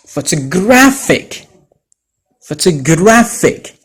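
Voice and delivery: "photographic" stressed on graph. Shown as a demonstration. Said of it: "Unlike photograph".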